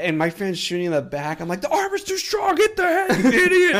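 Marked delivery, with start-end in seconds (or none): 1.6-3.8 very high, loud laughing yell at a teammate; mock battle yell. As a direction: bark orders at the friend, cracking up. Yelling at friend during shootout.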